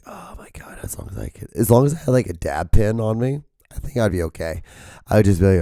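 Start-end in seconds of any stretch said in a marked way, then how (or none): 0.0-1.5 whispered, voiceless mock relief; hushed and breath-only; strained whisper. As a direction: squeeze it out in a spent whisper. Imagines being high, hushed and strained.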